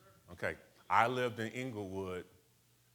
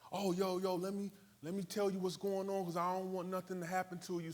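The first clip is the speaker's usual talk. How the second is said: much higher mock street voice turned friendly; friendly warning; 10 semitones higher than usual. Gang member warning the new kid about the streets, high mock voice. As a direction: warn him like a protective homie, pitched high. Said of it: "gang member warns him".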